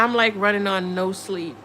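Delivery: ordinary talk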